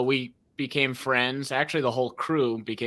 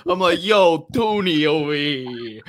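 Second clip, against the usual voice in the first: put-on New York accent; 6 semitones higher, 6 dB louder than usual. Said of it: "I'm doing the whole thing in a New York accent".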